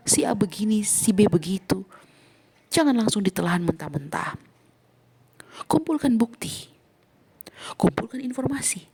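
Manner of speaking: ordinary talk, nothing marked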